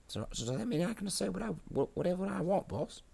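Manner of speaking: high, fast grot voice; timid grot minion. Acted. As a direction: ask the boss in a rushed high squeak. Grot questions its boss.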